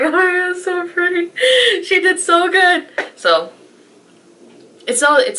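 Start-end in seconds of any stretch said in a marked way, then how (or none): 0.0-2.9 much higher, gushing squeal; high thrilled gushing voice. Retold or imitated. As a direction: gush over how pretty it is. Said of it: "I was like".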